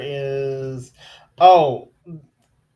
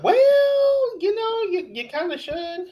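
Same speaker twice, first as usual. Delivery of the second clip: sarcastic high-pitched retort, much higher; blunt mock advice. Telling the cast member she kinda should leave, high mocking voice. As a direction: tell her off in a high, mocking voice. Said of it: "I'm like".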